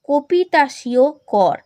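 কপিতাসীয় spelled out slowly, syllable by syllable; teacher drawing out কপিতাসীয় so students learn it; slow careful naming. Shown as a demonstration. Teacher slowly pronouncing a tax name for students. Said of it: "এই করগুলোর নাম কিন্তু মনে রাখবে".

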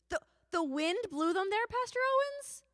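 high, loud incredulous question; hammed-up amazed questioning, rising to a squeak; mock astonishment. Voicing the children's amazed question.

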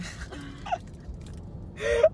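shrieking giggle; high squealing laugh. Real, not acted. Drunk giggling at the camera.

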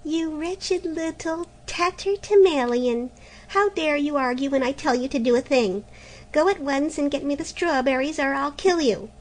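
put-on stepmother raging at the girl; furious scolding. A narrator's reading.

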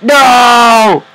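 high loud despairing no; loud pained no; real shout. Real, not acted. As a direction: wail it in real dismay. Fails in game.

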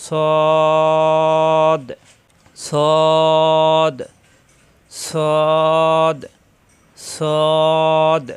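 firm, thick model সদ; Arabic letter sad. Shown as a demonstration. Teacher models heavy সদ for the class. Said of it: "সদ হবে না".

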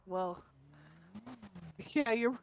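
usual speaking voice

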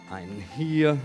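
ordinary talk

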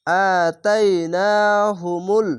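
আতাইনাহুমুল slow, each long vowel pulled; teacher showing the alif stretch. Shown as a demonstration. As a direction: draw out আতাইনাহুমুল syllable by syllable. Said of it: "খাড় জাবারে এক আলিফ টান".

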